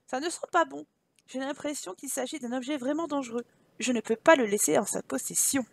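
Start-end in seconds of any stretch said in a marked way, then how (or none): none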